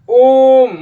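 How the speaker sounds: ओम stretched very long and loud; prolonged pluta vowel. Shown as a demonstration. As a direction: pull ओम out as far as you can. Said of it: "जितना दूर खींच सकते हैं". (ओम को बहुत लंबा खींचकर)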